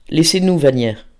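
ordinary delivery, nothing marked

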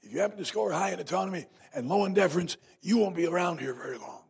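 loud, high, fast warning from a teacher; a teacher shouting a warning at a student; stern teacher warning. Retold or imitated. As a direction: bark it like a stern teacher laying down the law. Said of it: "the teacher said".

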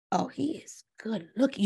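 breathy whisper of attraction; woman at the party whispering she likes him; whispered aside. Retold or imitated. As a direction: whisper it to yourself. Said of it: "you're like, Oh".

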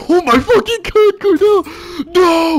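high, loud despairing cry; frustrated yell. Real, not acted. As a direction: scream out in dismay. Dying in game, yelling.

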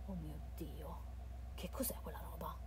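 hushed, scared question; awed fearful whisper; frightened whisper. Acted. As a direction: whisper in horror at the creature. Horrified character sees the thing.

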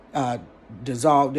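ordinary talk

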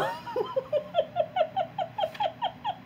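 squeaky high breathless giggle; high giggling fit. Real, not acted. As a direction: crack up at yourself helplessly. Cracking up at herself.

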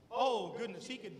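usual speaking voice

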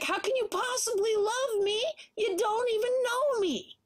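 high, incredulous protest. Retold or imitated. To the woman who loves her, high incredulous outburst. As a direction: fling the question back in disbelief. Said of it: "It's like".

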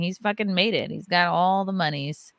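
usual speaking voice

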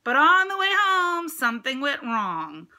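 high, loud suspense build; dramatic storybook turn; suspenseful narration. A narrator's reading. Reads picture book aloud.

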